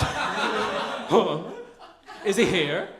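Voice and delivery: lightly voiced curious gossip, laughing; mock wide-eyed eavesdropper; eager Muppet Babies. Staff crowding the doorway, eagerly wondering if he's here, laughing. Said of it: "like the Muppet Babies".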